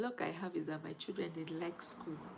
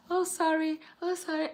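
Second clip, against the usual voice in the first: higher, louder imitation of passers-by; mock sorry; 10 semitones higher, 7 dB louder than usual. Imitating strangers saying sorry after she fell. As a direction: mimic strangers apologising. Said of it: "So they were like".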